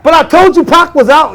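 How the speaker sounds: high, loud retort; arguing back. Retold or imitated. Answering his brother, high and loud. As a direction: defend yourself.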